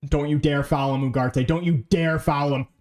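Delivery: ordinary delivery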